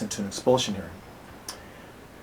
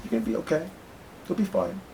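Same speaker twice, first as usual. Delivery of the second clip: gentle, much higher reassuring voice; soft, higher, smiling words of comfort; smiling reassurance; 10 semitones higher, 7 dB quieter, faster than usual. Retold or imitated. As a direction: comfort a nervous kid with a smile. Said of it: "so I smiled at him and said".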